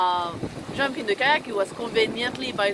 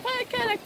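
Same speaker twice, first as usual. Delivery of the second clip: squeaky paddle noise; 14 semitones higher than usual. Retold or imitated. Paddle falling imitated.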